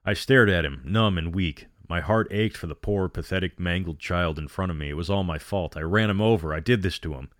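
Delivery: ordinary delivery, nothing marked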